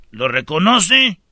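ordinary delivery

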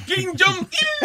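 Kim Jong-il drawn out slow and high, laughing; slow laughing mock name. Mocking Korean name.

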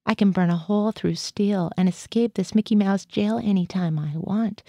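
plain reading voice

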